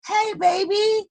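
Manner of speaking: high, stretched-out sing-song hello; delighted greeting. Real, not acted. Host greets viewer who found her, slow and sing-song. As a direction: draw out each welcome with delight.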